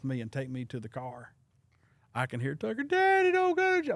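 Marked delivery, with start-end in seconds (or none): 2.8-3.9 put-on high, loud child's plea; little son. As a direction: cry out like the little boy watching his dad arrested. Son crying out.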